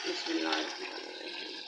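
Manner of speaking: soft whisper, the words barely voiced; whispered blessing. Real, not acted. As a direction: breathe the blessing to herself as a private prayer. Reciting bismillah while pouring water.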